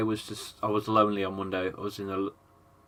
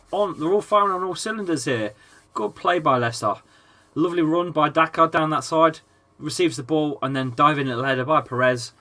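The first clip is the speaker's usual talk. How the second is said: louder, higher commentary build; excited play-by-play; 5 semitones higher, 6 dB louder than usual. Acted. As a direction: call the game like a commentator. Live commentary on the match.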